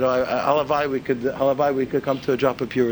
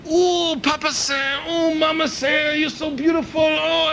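high, slow, effusive Chinese waiter; 12 semitones higher, much slower than usual. Acted. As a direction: greet the regulars with a beaming accent. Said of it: "the waiter says".

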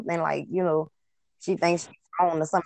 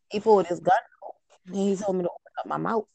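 ordinary delivery

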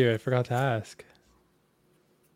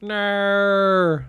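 drawn-out, higher Aussie vowel; mock Australian accent; 6 semitones higher than usual. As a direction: stretch it into a parody Australian whine. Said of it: "make fun of an Australian accent".

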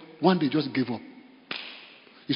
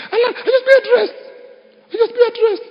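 very high, loud, laughing squeal; 5 dB louder than usual. Real, not acted. Preacher amused that money came without asking, laughing. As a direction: squeal it through real amusement. Laughing over brother giving unasked.

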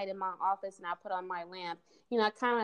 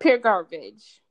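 slow, loud and flat-out dismissive; emphatic dismissal; 2 semitones lower, 10 dB louder, much slower than usual. Real, not acted. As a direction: drag out each word, loud and scornful. Blunt dismissal of his claim.

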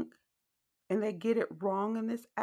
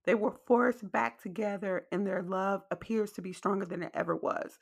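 normal talking voice

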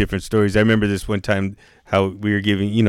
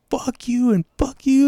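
put-on high, drawn-out kid tantrum; mock childish yell; imitated yelling; slower than usual. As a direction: squeal it slowly like an angry kid. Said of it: "He's fucking yelling at me".

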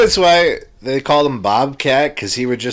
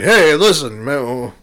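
Rodney Dangerfield; 3 semitones higher, 4 dB louder than usual. Acted. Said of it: "Rodney's gotta step in".

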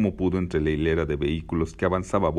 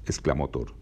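plain reading voice

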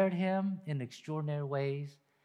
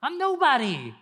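high, louder pleading as Moses; despairing Moses, higher and louder; 8 semitones higher, 6 dB louder than usual. Retold or imitated. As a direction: cry out Moses' self-doubt. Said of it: "He says".

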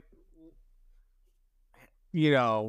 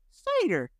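higher, a lightly voiced cider lover; mock old drinker; 10 semitones higher than usual. Mocking the last cider drinkers, light higher voice. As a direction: toss it off like an old-timer's choice.

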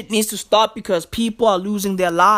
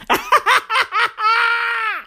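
burst of laughter; 13 semitones higher than usual. Real, not acted. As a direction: lose it laughing for real. Laughing at the rapper lists.

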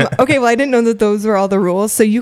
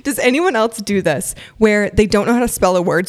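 ordinary talk

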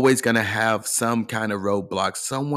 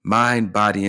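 ordinary talk, nothing marked

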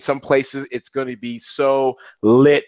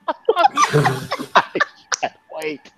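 high, helpless laughter through the words; cracking up mid-sentence; laughing; much slower than usual. Real, not acted. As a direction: squeeze the words out between laughs. Cracking up after own impression.

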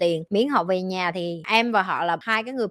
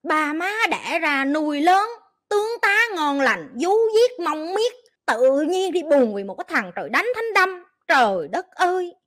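much higher, drawn-out exasperated scolding; mock outrage; 6 semitones higher than usual.

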